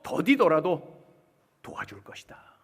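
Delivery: slowing, falling to a hush; God's reassuring voice. Acted. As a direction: divine promise, fading away. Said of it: "자세히 가르쳐 주셨어요".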